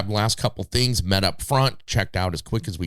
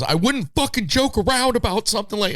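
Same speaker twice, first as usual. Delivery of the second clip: exasperated snap; 13 semitones higher than usual. Retold or imitated. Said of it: "And he goes".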